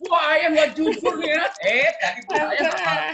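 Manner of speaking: high, loud, playful accusation; mock indignant protest. Spouse caught out over hidden money, mock outraged. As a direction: protest loudly, pitched right up, in fun. Acted marital protest, amid laughter.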